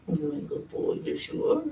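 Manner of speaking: whispered praise. Real, not acted. Soothing the cat softly.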